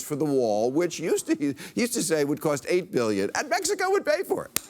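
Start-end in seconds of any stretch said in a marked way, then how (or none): 3.3-4.6 very high, quick tag line; high-pitched incredulous jab; mocking squeal. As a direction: toss it out in a high, rushed voice. Mocking a broken campaign promise.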